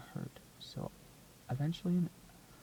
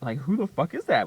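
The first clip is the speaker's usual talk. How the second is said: louder delighted shout; louder excited repeat; 14 dB louder than usual. Retold or imitated. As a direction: push the repeat even harder. Hearing the radio, laughing.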